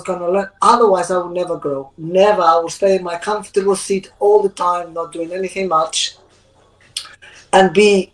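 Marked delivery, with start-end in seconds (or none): none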